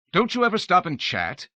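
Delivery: loud, high frustrated shout; exasperated cry. A narrator's reading. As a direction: yell after the fleeing shape. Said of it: "he cried".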